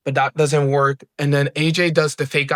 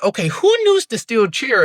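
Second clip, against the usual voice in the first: imitating the referee; 8 semitones higher than usual. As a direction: play the referee demanding an answer. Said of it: "the referee's like".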